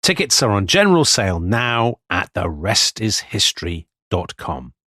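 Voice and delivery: upbeat podcast ad read for the tour. Acted. Two hosts pitching tour tickets to Australian listeners, ad read. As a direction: sell the live shows brightly to listeners.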